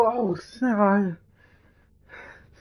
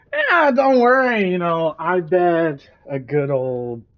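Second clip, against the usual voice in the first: higher, loud, slow confident boast; alien bettor; 5 semitones higher, 7 dB louder, slower than usual. Acted. Alien Mike boasting his bet, slow and loud. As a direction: lean into a smug wager. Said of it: "Mike is just like".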